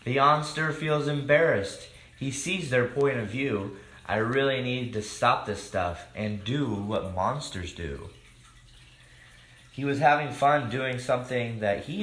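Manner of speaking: lively rhyming storybook read-aloud; reading to children. A narrator's reading. Host reading a picture book to children, lively. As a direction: read the rhymes out to the kids.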